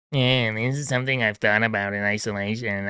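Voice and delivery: a thin, mousy mock of the caller; mocking weak guy. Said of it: "You go".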